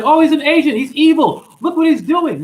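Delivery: high, loud mock alarm; mocking outraged accusers. Mocking accusers who see evil.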